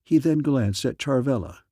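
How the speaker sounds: ordinary delivery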